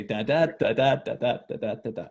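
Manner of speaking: da da da tapped out evenly; beating the rhythm. Shown as a demonstration. Teacher tapping out the line's beats. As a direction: chant the beats steadily.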